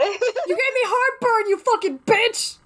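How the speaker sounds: high, slow mock outrage. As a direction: shriek it in fake outrage.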